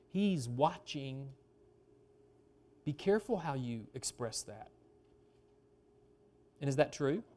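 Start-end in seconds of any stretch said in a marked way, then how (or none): 0.1-4.7 low, soft cautioning voice; quiet, measured word of caution; hushed warning. Acted. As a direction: drop low and quiet, as if someone is watching. Ominous reading of the phrase.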